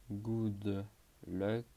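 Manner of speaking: English in a very French accent; heavily French-accented English; strong French accent. Shown as a demonstration. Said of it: "Avec mon accent français très prononcé".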